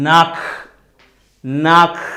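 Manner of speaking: "నాక్" ending in a scraped kh. Shown as a demonstration. Teacher modelling German CH after A, clear kh. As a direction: let "నాక్" end in an audible throaty breath. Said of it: "ఖ అనేది స్పష్టంగా పలుకుతుంది".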